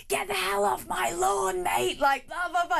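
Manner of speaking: furious neighbour chasing someone off his grass; angry shout. Retold or imitated. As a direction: bellow it at the Prime Minister on your lawn. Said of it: "the guy just came out of his house and was like".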